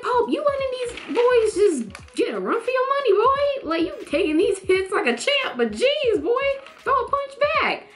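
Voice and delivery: high-pitched big-sibling scolding; incredulous, high-pitched egging on; exasperated scolding. Pretend. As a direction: scold him in a high, worked-up voice. Scolds character like baby brother.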